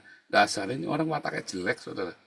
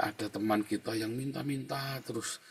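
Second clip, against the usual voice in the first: ordinary delivery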